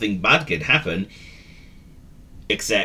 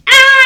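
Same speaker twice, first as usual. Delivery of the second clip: very high, loud howling cry; a dog wails after a kick; pained wail; 10 dB louder than usual. Acted. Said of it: "wailed Willy".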